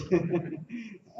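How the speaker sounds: short laugh. Real, not acted. Laughs after own remark.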